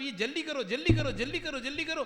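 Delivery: high, loud, repeated hurry-up; pressing urgency. Acted. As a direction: act out impatient pressure from behind, loud and high. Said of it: "बार बार आपको pressure पड़ रहा है". (ज़ोर से, ऊँची आवाज़ में हड़बड़ी)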